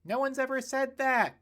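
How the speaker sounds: high, loud heckle; teasing heckler yelling at the speech; heckling yell. Acted. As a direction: shout it from the back of the crowd. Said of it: "yelled the peanut gallery".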